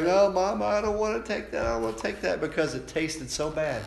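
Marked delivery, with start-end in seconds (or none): 0.0-2.4 put-on higher, whiny kid refusing medicine; a child pleading with Mama; childhood whine. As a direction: kid to his mother, higher whining no. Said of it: "when I was a kid, like".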